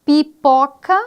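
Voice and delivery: teacher showing the example word pipoca; stressed example word. Shown as a demonstration. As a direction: present the word pipoca clearly to the child. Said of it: "coloquei o exemplo aqui de".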